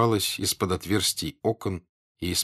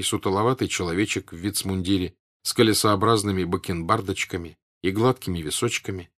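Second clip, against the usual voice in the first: usual speaking voice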